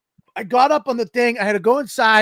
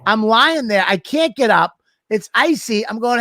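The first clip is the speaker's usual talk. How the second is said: normal talking voice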